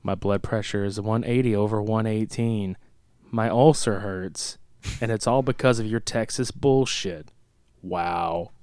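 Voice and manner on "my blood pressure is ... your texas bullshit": low, soft monotone imitation; Ben Stein deadpan. Host imitates the angry boss's line, low deadpan. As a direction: drone it low and quiet like an unemotional actor. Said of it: "Imagine Ben Stein giving that fucking".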